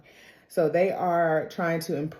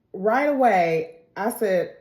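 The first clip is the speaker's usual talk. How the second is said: usual speaking voice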